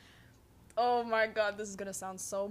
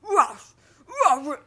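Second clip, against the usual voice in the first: loud high zombie bark; 6 semitones higher, 17 dB louder than usual. Retold or imitated. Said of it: "make zombie noises".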